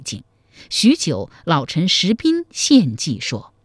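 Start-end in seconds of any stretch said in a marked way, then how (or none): none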